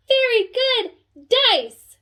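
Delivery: gasp, then high, loud, slow praise; mock delighted gasp. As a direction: overplay your delight for kids. Teacher delighted with class.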